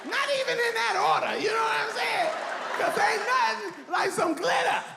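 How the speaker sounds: high party yell building to a scream; rowdy, high hollering, ending in a war cry; hyped-up shouting. Acted. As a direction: whoop it up like a wild partier. Hyped party rant about cocaine.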